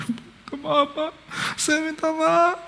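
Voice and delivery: high, soft, slow pleading apology; tearful pleading. Retold or imitated. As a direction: beg forgiveness in a small, tearful voice. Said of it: "Dia nangis".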